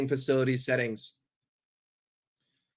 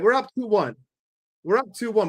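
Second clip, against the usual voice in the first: usual speaking voice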